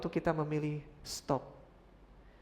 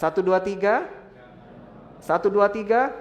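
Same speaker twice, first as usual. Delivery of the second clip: loud count-in; 6 semitones higher, 11 dB louder than usual. Real, not acted. Counting in the congregation.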